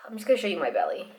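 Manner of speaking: normal talking voice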